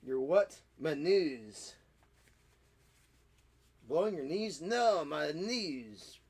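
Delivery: drawn-out, hammed-up French comic routine; ridiculous French accent. Clowning about blowing the nose, ridiculous French accent. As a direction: swoop through the vowels in silly French. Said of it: "that is my ridiculous French accent".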